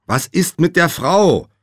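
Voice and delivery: loud, high, drawn-out demand; insistent questioning. A narrator's reading. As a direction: press slowly and loudly for an answer. Josh presses groggy Nate.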